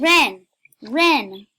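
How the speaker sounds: loud, repeated name call; yelling. Real, not acted. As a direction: yell the name over and over. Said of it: "I'm just gonna yell at this girl".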